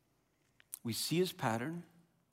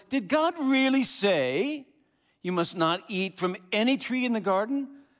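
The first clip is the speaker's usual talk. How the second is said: sly serpent; 7 semitones higher, 5 dB louder than usual. Acted. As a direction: draw out the question like a tempter. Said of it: "He said to the woman".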